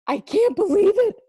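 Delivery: very high, loud stunned cry; shocked disbelief. Acted. Donut learns all donuts get eaten, stunned cry. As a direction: blurt it out in horror. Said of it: "Arnie gasped".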